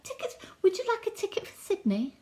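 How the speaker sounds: high, small ticket-seller's offer; tiny voice offering a ticket; little voice. Retold or imitated. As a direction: imitate a small, high-pitched stranger. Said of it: "like, voice saying".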